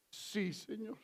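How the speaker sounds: nearly breathed, humble reply; submissive murmur. Retold or imitated. Preacher echoing the blind men, meek and hushed. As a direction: echo it meekly, barely there. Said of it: "Qué respuesta tan linda, tan sometida".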